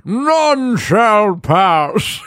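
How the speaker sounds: slow, drawn-out knight impression; Monty Python knight. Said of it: "makes me think of Monty Python".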